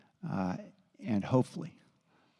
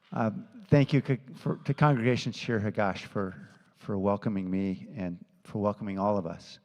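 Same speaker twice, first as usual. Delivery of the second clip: ordinary delivery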